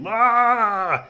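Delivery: loud, high drawn-out yell; roar. Acted. Camper leaping up at the lantern holder, roaring. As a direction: let out a high startling roar. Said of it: "och, och vrålar".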